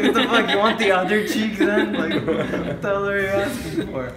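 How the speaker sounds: high, loud mock outrage; acted-out grandma at wit's end; retold exasperation. Grandma answering her picky grandson, mock exasperated.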